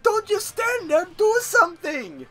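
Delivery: high, panicked mom in a bad German accent. Mom yelling as kid falls in chocolate river, bad German accent. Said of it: "that was my bad German accent".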